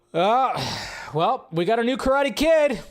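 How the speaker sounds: normal talking voice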